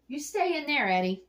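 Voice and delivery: loud, high stern command; stern order. Real, not acted. Ordering Eddie the turtle back inside, loud and high.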